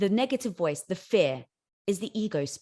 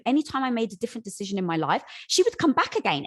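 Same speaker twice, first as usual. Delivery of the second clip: ordinary talk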